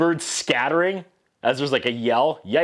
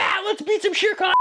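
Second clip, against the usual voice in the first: put-on squeaky child voice, much higher, cheering; high mock kid cheer. Mowgli rallies the animals, high squeaky kid cheer. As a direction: fire it off like an inspired little boy. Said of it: "and he's like".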